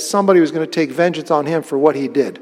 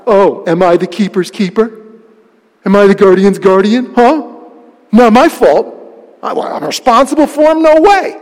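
sarcastic; 3 semitones higher, 6 dB louder than usual. Retold or imitated. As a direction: throw it back at God with scorn. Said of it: "Cain is sarcastically saying to God".

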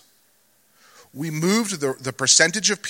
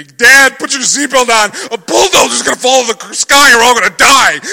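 high, loud panicked shouting; kids screaming; 8 semitones higher, 13 dB louder than usual. Retold or imitated. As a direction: shriek it like terrified kids in unison. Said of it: "are screaming at me".

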